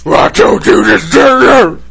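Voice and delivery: loud, high monstrous devil voice. Retold or imitated. Host mimicking Taja suddenly turning on the boy, devil voice. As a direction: shout it like a possessed demon. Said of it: "goddamn devil voice".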